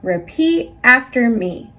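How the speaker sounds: usual speaking voice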